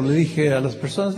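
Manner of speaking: ordinary talk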